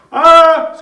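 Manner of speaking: loud high mock scream; short shriek of terror, hammed-up; freaked-out scream. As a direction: scream in acted panic.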